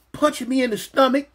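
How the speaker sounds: ordinary delivery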